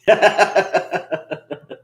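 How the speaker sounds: real laugh at his own joke; amused laugh. Real, not acted. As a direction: chuckle at the comparison. Joke about his accent.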